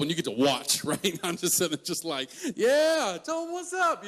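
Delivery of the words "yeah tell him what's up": high-pitched mock cheer; gleeful cheering. Younger brother watching his brother get teased, gleeful cheering. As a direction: egg them on with relish, pitch way up.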